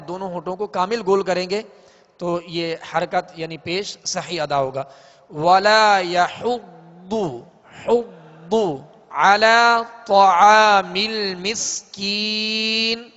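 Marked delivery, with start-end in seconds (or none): 5.3-13.1 slow chanted tajweed, long held endings; sustained, melodic verse chanting; Quran recitation. Shown as a demonstration. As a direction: recite slowly for students to repeat.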